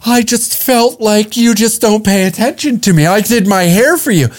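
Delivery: hint of a sulking partner; mock hurt woman. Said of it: "female that has just been caught in a lie".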